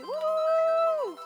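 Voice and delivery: high, loud woo; excited cheer. Real, not acted. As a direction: whoop for the gifted subs. Cheering for gifted subs.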